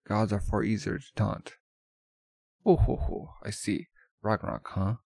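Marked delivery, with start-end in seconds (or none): 2.6-3.3 high, loud oh-ho-ho laugh; haughty laugh. Acted. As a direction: laugh down at them like a queen. Goddess amused by the proposal.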